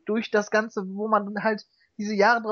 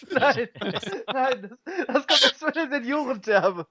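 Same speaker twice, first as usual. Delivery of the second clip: laughing through the words; giggling, can barely get it out; cracking up mid-line; 3 semitones higher than usual. Real, not acted. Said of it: "fünf Minuten Lachflash".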